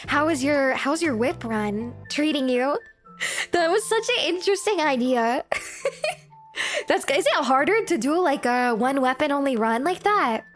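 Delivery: usual speaking voice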